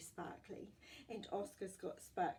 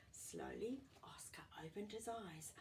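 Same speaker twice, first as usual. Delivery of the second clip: hushed storybook whisper; suspenseful whisper; 3 semitones higher, 4 dB quieter than usual. A narrator's reading.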